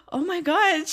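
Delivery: mock gasp, then high-pitched amazement; delighted shock. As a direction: act out being thrilled and astonished. Acting Rain's delighted shock.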